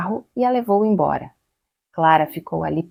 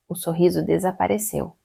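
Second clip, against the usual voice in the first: usual speaking voice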